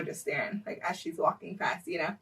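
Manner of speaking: usual speaking voice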